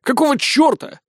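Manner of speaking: high-pitched baffled outburst; shocked. A narrator's reading. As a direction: exclaim it in high alarm. Shock at finding chained granny. (высоко, ошарашенно)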